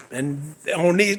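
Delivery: usual speaking voice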